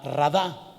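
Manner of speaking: radá with the stress on the final dá; stress on second syllable. Shown as a demonstration. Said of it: "con acento en la segunda".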